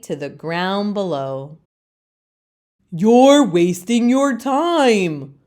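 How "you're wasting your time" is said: slow, louder chorus of leaves; know-it-all leaves. Acted. Other leaves scold Leaf, slow and loud. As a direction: lecture it slowly like smug elders. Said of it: "the other leaves say again".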